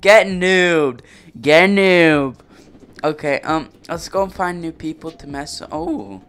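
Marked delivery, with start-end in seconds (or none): 0.0-2.4 loud gloating yell; gloating shout. Real, not acted. Gamer gloating loudly over a beaten player. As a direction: bark the taunt as you win. Taunt while killing player.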